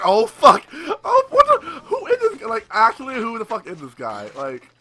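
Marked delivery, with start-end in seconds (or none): 0.1-2.5 high, loud, mock-terrified yelp; hammed-up terror of a hunted villager; panicked villager. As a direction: play the fleeing man in panic. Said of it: "He's like".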